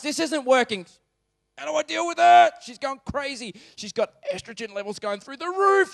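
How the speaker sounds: a worried husband at his wit's end; anxious husband. Acted. Voicing Abraham's anxious inner monologue.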